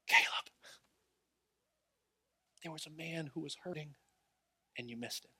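retold quiet rebuke from the Holy Spirit; hushed divine voice. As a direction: speak it gently, almost under your breath. Holy Spirit speaking softly to preacher.

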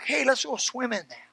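normal talking voice

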